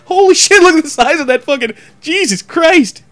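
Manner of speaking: gawk in fake horror; mock shocked gasp. Onlookers gawking at the thong, mock shock. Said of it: "get pissed when people go".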